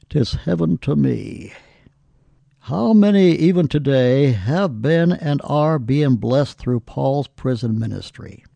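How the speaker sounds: usual speaking voice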